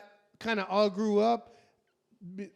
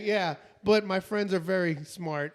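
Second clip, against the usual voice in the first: ordinary delivery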